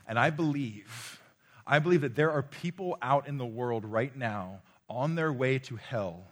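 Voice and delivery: loud, driving preacher's proclamation; preaching at full force. Acted. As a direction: press the claim hard on the crowd.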